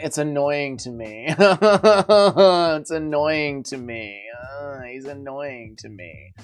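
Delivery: softly drawled impression in a fighter's accent, with a laugh; John Jones accent. Streamer guessing which accent he does, quiet fighter's accent impression. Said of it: "I think it's the John Jones accent".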